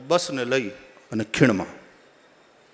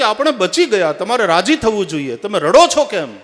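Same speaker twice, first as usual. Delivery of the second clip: loud, high, emphatic questioning; insistent wife; 6 semitones higher, 8 dB louder than usual. Retold or imitated. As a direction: press the weeping husband for an answer, voice raised. Wife questions her crying husband. (ઊંચા અવાજે પત્નીનો આગ્રહભર્યો સવાલ)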